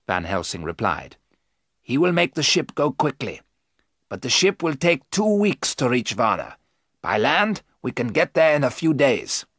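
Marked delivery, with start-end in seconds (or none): 1.8-9.5 higher-pitched, deliberate explanation; reasoning professor. Acted. Van Helsing explains the land route, reasoning. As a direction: lay out the plan in a raised voice.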